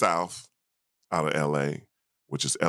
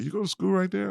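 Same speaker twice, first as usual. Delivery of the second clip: amazed coach. Retold or imitated. Coach amazed at his answer.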